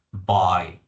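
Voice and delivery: "Buy" said as a low, open, glottal "bo"; teacher's model "buy". Shown as a demonstration. Teacher models "Buy" for students to repeat, low and glottal. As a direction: model "Buy" with little lip movement. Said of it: "as the pronunciation al frente es buy".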